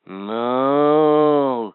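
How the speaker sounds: a low drawn-out moo; low cow moo. Acted. Toy quizzing the child, a low cow moo.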